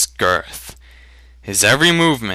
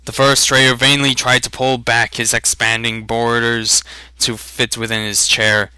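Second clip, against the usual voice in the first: ordinary delivery, nothing marked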